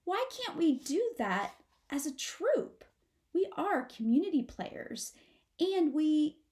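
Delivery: put-on high child voice, pleading; high-pitched baby possum protesting; small possum asking. A narrator's reading. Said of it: "as he asks".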